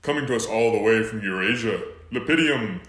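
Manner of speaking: boxing-match fighter introduction; ring announcer. Acted.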